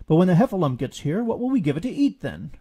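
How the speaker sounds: fast, loud fretting; worried Rabbit. A narrator's reading. Rabbit worries to his friends, fretting. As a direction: fret out loud. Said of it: "Rabbit wondered".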